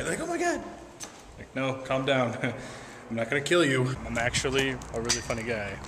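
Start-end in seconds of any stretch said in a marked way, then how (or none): 0.2-0.6 high, soft mock-frightened squeak; mock scared. Skinny girl seeing a big guy, mock scared. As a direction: imitate a startled squeal, pitch jumping way up. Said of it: "always get scared whenever they see".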